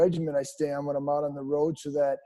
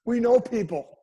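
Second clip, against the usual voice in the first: a light, raised mock mafia boss line; mock mobster; 6 semitones higher than usual. Teasing the radio host like a playful mob boss. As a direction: play the wise guy who has connections. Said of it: "start calling you Gambino".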